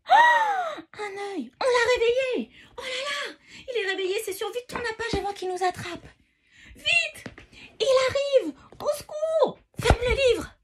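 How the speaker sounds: high, loud mock panic; panicked shouting. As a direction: yell in playful terror. Giant wakes, reader panics.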